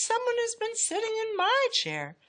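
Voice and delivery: high-pitched mama bear; middle-sized Mama Bear voice. A narrator's reading. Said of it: "said Mama Bear in her middle-sized voice".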